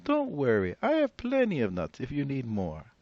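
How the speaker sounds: put-on high, bright old granny greeting a guest; old woman voice. Old woman welcoming the rabbi at her door, high and cheery. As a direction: play the cheerful old grandmother.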